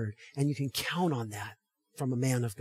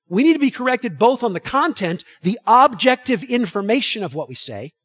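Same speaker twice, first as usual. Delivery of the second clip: loud, raised, emphatic sermon voice; forceful preaching; 8 semitones higher, 9 dB louder than usual. Real, not acted. Preacher teaching a congregation about tone, forceful and loud. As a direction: drive each point home to the congregation.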